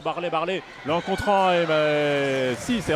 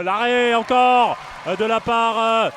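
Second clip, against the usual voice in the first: higher and louder, rising to a shout; excited sports commentary; 5 semitones higher, 5 dB louder than usual. Real, not acted. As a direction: build excitement into the goal. Co-commentator celebrates save and goal.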